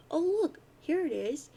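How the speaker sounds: high, loud sarcastic exclamation; mock discovery. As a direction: exclaim it with exaggerated indignation. Indignant rant about displaced toilet.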